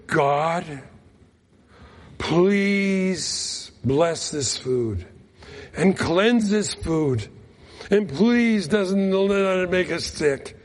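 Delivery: very slow, drawn-out pleading grace; slow earnest prayer. Retold or imitated. Praying over suspect food at a village dinner, slow and earnest. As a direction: stretch every word, begging for safe food. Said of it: "we prayed over the dinner".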